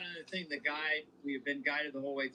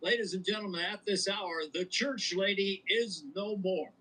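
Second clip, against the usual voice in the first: news report; 4 semitones higher than usual. Acted. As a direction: announce it like breaking news. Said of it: "I just did a news report".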